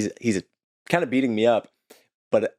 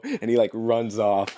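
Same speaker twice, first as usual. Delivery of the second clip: ordinary talk, nothing marked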